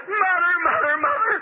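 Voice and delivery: very high-pitched imitation of a husband crying out; imitated outcry to a wife revived; retold overwhelmed cry. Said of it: "her husband was crying, praying".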